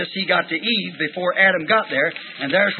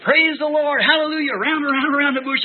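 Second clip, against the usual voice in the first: much higher, ecstatic praise shout; retold joyful shout; 9 semitones higher than usual. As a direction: cry out praise, caught up in joy. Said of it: "I was screaming".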